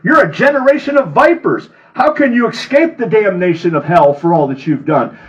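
loud, high furious preacher voicing Jesus; fiery denunciation of the vipers; fired-up rebuke. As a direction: hurl the insult at them. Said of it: "he was fired up".

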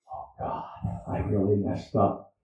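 breathy, hushed confession; guilty whisper. Retold or imitated. The sinner caught by the Lord's gaze, hushed guilt. Imagined guilty inner cry.

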